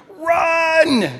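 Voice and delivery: sudden high, loud scream of alarm. Retold or imitated. Mom screaming at her kids to run. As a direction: yell it like a panicked mom. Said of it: "she screamed at her children".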